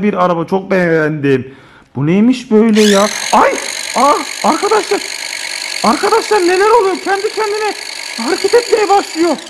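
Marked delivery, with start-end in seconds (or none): 3.3-9.4 pitched up, amazed the car moves by itself; surprised. Real, not acted. Dad to viewers, astonished the toy car starts moving. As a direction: gasp it out, eyes wide at the toy.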